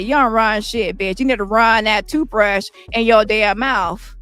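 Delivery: usual speaking voice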